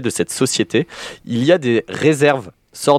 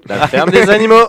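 higher and louder, insisting; loud insistent repeat; 8 semitones higher, 6 dB louder than usual. Real, not acted. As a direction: call the answer out louder.

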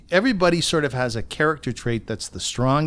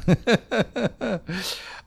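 high breathy laugh; quick amused laugh; 8 semitones higher than usual. Real, not acted. Host laughs after plug.

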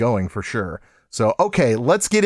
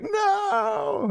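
high drawn-out mock shout; exaggerated wail of protest, imitating everyone; mock outcry; 5 dB quieter than usual. Imitating everyone protesting about hair growing back, high mock outcry. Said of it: "Everyone's like".

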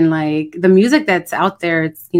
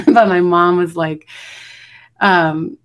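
usual speaking voice

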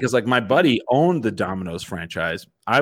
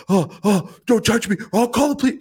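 high frightened squeak, rising; imitating a panicky little guy begging off; small man pleading not to be hit, high scared voice; scared whimper; 12 semitones higher than usual. Scared small man flees confrontation.

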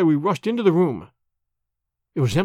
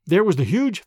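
usual speaking voice

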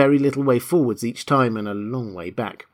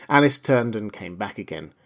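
ordinary delivery, nothing marked

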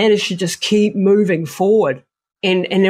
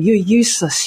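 a hint of a mocking snake voice; sneering inner snake; 3 semitones higher than usual. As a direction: sneer it like a snake in your head. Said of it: "the snake on the other side going".

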